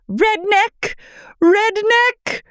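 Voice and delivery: very high, shrieked correction; imitating Whitney. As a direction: cry out the correction.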